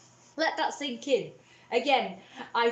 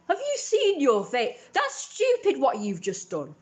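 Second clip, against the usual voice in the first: mum's scornful snap; 7 semitones higher, 6 dB louder than usual. Retold or imitated. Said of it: "she was like".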